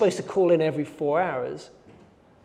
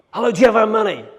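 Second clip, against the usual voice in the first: menacing demand; 6 semitones higher, 7 dB louder, much faster than usual. Retold or imitated. Kidnapper demands ransom on phone.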